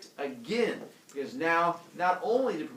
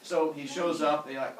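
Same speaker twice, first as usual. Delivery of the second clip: ordinary delivery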